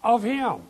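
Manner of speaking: "Of him" said high with a punch; "Of him" lifted high and stressed; emphatic of him. Shown as a demonstration. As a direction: ring out Of him like the key word. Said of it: "It's emphatic".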